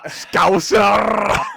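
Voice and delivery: loud, high, drawn-out joke yell; sudden playful shout. Pretend. Teasing friends about sexy accents, a playful shout. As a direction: blurt it out at full volume, stretching the end.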